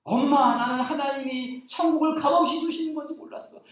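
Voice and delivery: put-on high, moved child's voice; a third-grader overwhelmed with joy. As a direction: speak as the tearful little boy. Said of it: "초등학교 삼 학년짜리 아이가".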